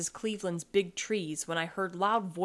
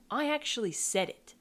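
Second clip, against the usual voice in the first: ordinary delivery, nothing marked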